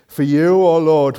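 ordinary talk, nothing marked

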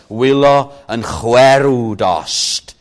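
Wylo yn chwerw dost drawn out slowly and weightily; strong emphasis. Shown as a demonstration. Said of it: "it comes across even stronger".

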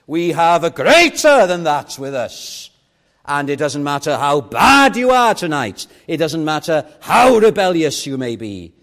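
loud, swelling sermon with punched key words; full-force preaching. Real, not acted. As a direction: thunder it from the pulpit.

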